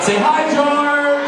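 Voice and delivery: calling out. Real, not acted. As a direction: hype the crowd into a cheer. Author cues crowd to greet George.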